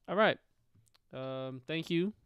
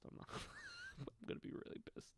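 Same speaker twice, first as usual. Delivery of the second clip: breathy laugh; 20 dB quieter, much faster than usual. Real, not acted. Laughing mid-rant.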